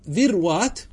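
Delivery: ذروات as "dhirwaat", no vowel after ر; slow, emphatic "dhir-waat" for ذروات; sukun on second letter. Shown as a demonstration. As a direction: say ذروات in two syllables, ر closing the first. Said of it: "وهذا بالسكون جائز".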